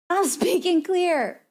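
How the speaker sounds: slow, high-pitched insistence; mock protest. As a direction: drag it out, playfully indignant. Protests that speech is clear.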